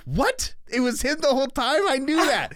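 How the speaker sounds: high, loud outraged disbelief; mock-scandalized reader reaction; indignant disbelief. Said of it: "I was like".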